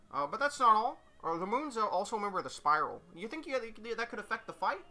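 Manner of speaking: high, tentative puppet reporter; hesitant high Kermit questioning. Acted. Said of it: "I gotta do the Kermit voice".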